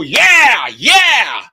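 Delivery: loud cheering yeah; crowd cheer. Retold or imitated. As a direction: shout like an excited crowd.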